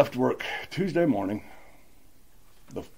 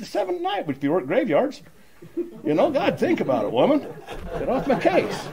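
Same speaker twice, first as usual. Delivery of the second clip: much higher, laughing mock retort; retorting to wife; 14 semitones higher than usual. Telling his wife about drinking after night shifts, high laughing retort. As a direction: toss it off like a cheeky excuse. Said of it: "I said".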